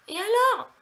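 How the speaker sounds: high-pitched "so what" comeback; defiant retort. Acted. Vlogger answering critics of her vocabulary, defiant retort. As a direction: toss it back, pitch leaping up.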